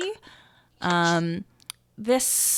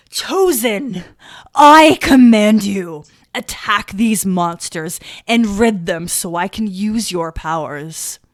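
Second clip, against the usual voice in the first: booming command, much louder; commanding old priest; 12 dB louder than usual. Acted. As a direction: order them like a desperate ancient priest.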